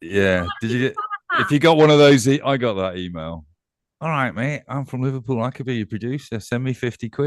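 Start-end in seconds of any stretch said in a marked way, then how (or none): 4.0-7.3 light put-on Manchester accent; chirpy Manc lad pitching a scam; a hint of Manchester. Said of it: "That was a Mank accent".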